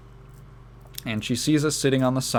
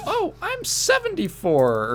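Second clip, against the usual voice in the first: put-on slow, very high old lady falsetto; older woman; slower than usual. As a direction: imitate an older lady cooing back, drawn out. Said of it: "And I said".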